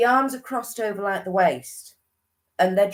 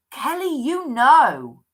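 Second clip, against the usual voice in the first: insistent urging; 6 semitones higher, 6 dB louder, slower than usual. Real, not acted. Urging the sitter again.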